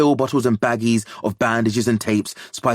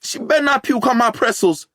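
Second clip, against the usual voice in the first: high, raised call back; 4 dB louder than usual. A narrator's reading. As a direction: shout it over your shoulder. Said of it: "Mateo calls back".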